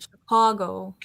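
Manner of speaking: put-on Chicago said with a broad Chicago accent, Shi-KAH-go; a playful Chicago accent. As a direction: imitate a Chicago Italian-American accent. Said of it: "Italian Americans say Chicago".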